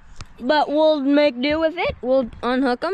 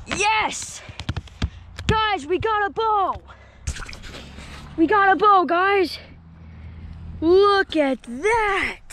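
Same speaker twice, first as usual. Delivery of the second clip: thrilled shout; 5 semitones higher, much slower than usual. Real, not acted. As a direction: shout it out, can't believe the catch. Landing the bow, thrilled.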